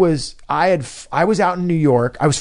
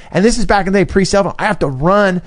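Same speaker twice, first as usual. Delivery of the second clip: usual speaking voice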